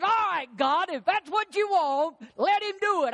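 high, sulky pouting voice; pouting David. Retold or imitated. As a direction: whine it like a sulking child. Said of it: "he pouts and said".

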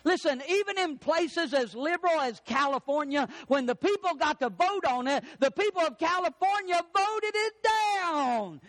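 high, driven sermon delivery; forceful preaching. Real, not acted. Preacher to congregation on voting, forceful preaching. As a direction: preach it hard, stretching the key phrase.

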